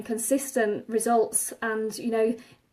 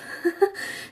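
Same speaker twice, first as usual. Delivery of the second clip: breathy laugh; 8 semitones higher than usual. Real, not acted. Apologetic laugh at herself.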